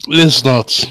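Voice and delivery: loud groan of bad luck; exasperated. Real, not acted.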